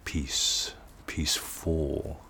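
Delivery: slow, soft whisper; whispered peace. Shown as a demonstration. Teacher demonstrating peace and peaceful, whispered. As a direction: breathe the words inward. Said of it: "Try this on".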